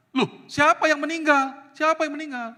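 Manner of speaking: high, loud shocked questioning; acted-out alarm, pitch jumping up; startled husband. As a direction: demand to know, taken aback. Acts startled husband questioning wife.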